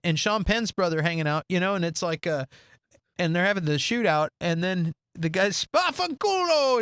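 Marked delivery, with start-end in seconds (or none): 5.6-6.8 put-on slow, higher Italian-accented curse on Spafanculo. Mobster swearing in the shootout, slow Italian-style Spafanculo. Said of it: "He's just speaking in, in Italian".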